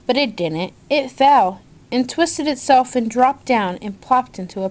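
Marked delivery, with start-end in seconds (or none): none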